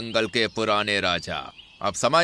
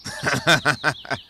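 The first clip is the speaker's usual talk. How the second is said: mocking laugh; 5 semitones higher than usual. बैल चुनौती पर हंसता.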